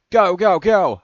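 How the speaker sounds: high, loud rallying yell; urgent shout. A narrator's reading. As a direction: shout to get everyone moving. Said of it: "Cody shout".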